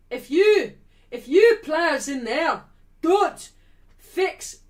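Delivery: loud, slow Scottish team talk; fired-up Scottish manager rollicking his players; Scottish accent. Acted. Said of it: "I'll put on my Scottish accent".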